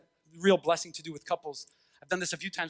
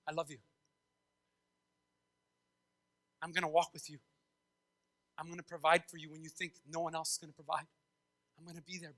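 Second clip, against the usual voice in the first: tender promise; 4 semitones lower, 11 dB quieter, faster than usual. Acted. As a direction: vow it softly and intimately. God promising love to his people.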